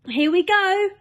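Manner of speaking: high, loud excited shout. Acted. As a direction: shout it while hopping with excitement. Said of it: "shouted the old green grasshopper".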